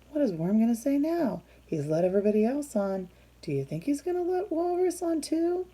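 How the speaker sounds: ordinary delivery, nothing marked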